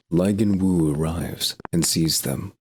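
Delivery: usual speaking voice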